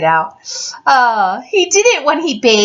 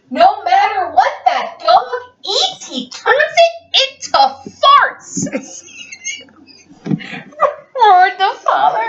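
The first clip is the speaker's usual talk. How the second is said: high, slow, breaking into giggles; laughing through a line; 10 semitones higher, slower than usual. Real, not acted. Parent cracking up while reading the father's roar. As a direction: fight to read through laughter.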